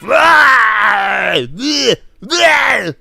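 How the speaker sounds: loud, high, snarling mock-rage blurts; Tasmanian Devil. Reviewer mocking the hero's rage in the movie, as a Tasmanian Devil yell. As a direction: spit it out like a cartoon Tasmanian Devil. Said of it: "like Tasmanian Devil".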